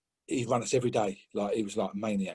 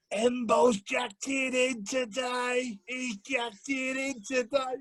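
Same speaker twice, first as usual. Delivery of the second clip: high, slow, drawn-out Welsh holler; mock Welsh manager shout; 12 semitones higher, much slower than usual. Welsh coach shaming the player who slowed down, mock high holler. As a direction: call it out long and high, roughly Welsh. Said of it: "I can't do his accent, he's Welsh".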